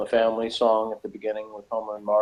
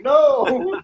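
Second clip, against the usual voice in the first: high, loud "No!" blurted out; playful alarmed yell; sudden shout; 6 dB louder than usual. Real, not acted. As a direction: cut him off with a loud high cry. Stops friend reading further.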